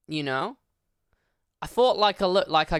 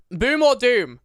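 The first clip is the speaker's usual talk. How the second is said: louder ask; 6 semitones higher, 6 dB louder, slower than usual. Shown as a demonstration. Streamer repeats poll to chat, louder and higher. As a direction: Boom or doom called out higher and louder.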